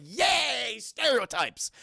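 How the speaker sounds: big fake hooray; mock cheer. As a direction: cheer it with hammed-up glee. Acted mock cheer in retelling.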